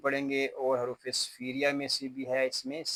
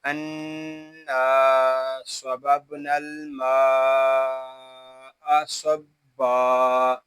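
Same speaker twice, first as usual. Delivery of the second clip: slow Quran recitation with long drawn vowels; full verse recitation; 8 dB louder, much slower than usual. Shown as a demonstration. Teacher reads the whole verse for learners, slow measured recitation. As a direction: recite slowly, holding each elongation. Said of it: "पूरी आयत को हम पढ़ेंगे".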